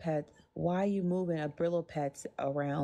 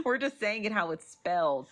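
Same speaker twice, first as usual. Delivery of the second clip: ordinary talk, nothing marked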